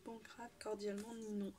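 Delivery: soft, whispered reading of a note; reading under breath. Real, not acted. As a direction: murmur the sign-off to yourself. Reads the gift note to herself. (lu tout bas, en chuchotant)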